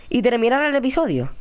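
usual speaking voice

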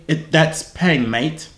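slow demonstration in mock British accent; put-on British. Shown as a demonstration. Teaching a stranger British slang, put-on British accent. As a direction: model British slang slowly, dropping on "mate". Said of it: "I'll teach you".

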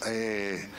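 usual speaking voice

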